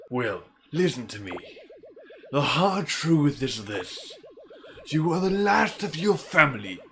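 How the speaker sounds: put-on old man voice, scolding with swinging pitch; old mentor. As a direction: lecture the boy like a village elder. Old man lectures boy.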